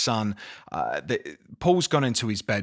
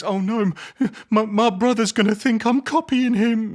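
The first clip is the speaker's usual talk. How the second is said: high, loud, fretful petty brother; mock the panicky little brother; a hammed-up petty sibling wailing over a menu; petty whiny brother; 10 semitones higher, 5 dB louder than usual. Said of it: "petty to the point of".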